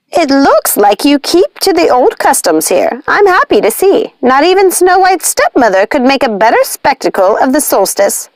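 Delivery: high, honeyed witch voice with a hint of barb; sweet on top, needling underneath; sly witch sister. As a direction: Lucinda needling Nanny with sugary politeness. Lucinda speaks to Nanny.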